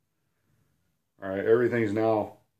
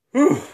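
loud, high whew of relief; relieved whew; 11 dB louder than usual. Real, not acted. Teacher relieved the lesson is finally over, loud high whew. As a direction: blow out a big whew, glad it's done. Relief after finishing the explanation.

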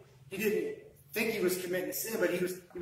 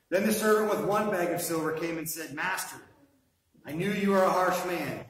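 louder, declaiming Bible reading; reading the servant's plea to the master from the pulpit; scripture reading; 2 semitones higher, 4 dB louder than usual. Acted. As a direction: proclaim the passage to the church. Reads scripture aloud to congregation.

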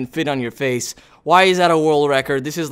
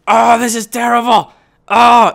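raised, pained complaint; pained outcry; 5 semitones higher, 5 dB louder than usual. Real, not acted. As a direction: wail about the torture. Crying out at pinching pins.

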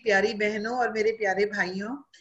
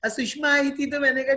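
ordinary talk

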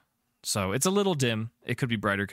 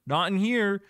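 high-pitched, slightly raised call; guard's shout; 10 semitones higher, 5 dB louder than usual. Acted. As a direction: call out a search report over alarms. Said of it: "You hear them shout out".

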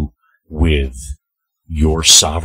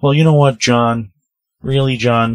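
getting angry; 7 semitones higher, 4 dB louder than usual. Real, not acted. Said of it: "I'm getting angry".

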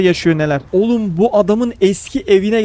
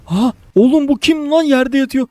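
normal talking voice

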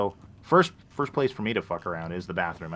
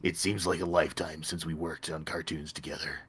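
put-on old smoker voice; 6 semitones lower, 8 dB quieter than usual. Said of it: "I apparently sound like an old smoker".

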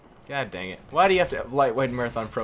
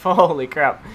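louder, laughing disbelief; laughing exclaim; 2 semitones higher, 8 dB louder, slower than usual. Real, not acted. Gamer laughing at a wild knife kill, loud and amused. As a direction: blurt it out through a laugh.

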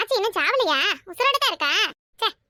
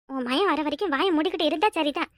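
normal talking voice

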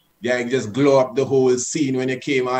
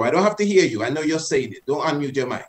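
normal talking voice

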